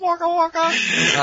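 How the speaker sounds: very high, slow "Waka waka"; silly clown. Acted. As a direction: stretch it out like a goofy clown. Comic catchphrase repeated.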